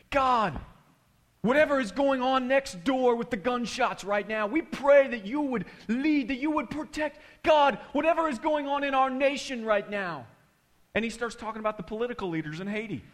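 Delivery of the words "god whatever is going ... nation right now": high, raised imitated prayer voice; loud fervent prayer. Pastor praying about gunshots next door, loud imitated plea. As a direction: cry out to God as the impassioned pastor.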